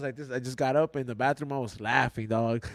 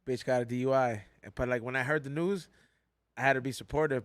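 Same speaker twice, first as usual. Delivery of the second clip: usual speaking voice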